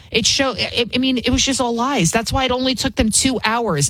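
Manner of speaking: ordinary talk, nothing marked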